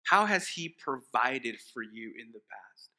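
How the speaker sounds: ordinary talk, nothing marked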